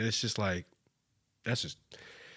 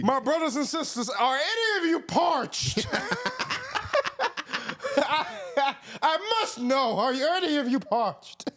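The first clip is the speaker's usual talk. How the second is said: mock preacher; 13 semitones higher than usual. Pastor asks the hot congregation if they're parched, laughing mock sermon.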